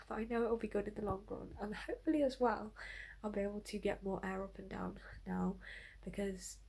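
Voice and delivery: hushed early morning whisper. Real, not acted. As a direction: keep it to a whisper.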